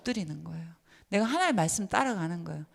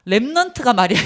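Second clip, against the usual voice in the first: loud scolding parent's yell; a parent's loud telling-off; scolding shout; 11 dB louder than usual. Retold or imitated. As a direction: bark it at the child like a cross parent.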